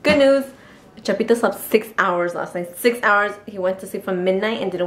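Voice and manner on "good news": put-on cheerful announcement, louder and higher; happy mood. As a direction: switch on the bubbly happy voice. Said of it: "Let me go into happy mood".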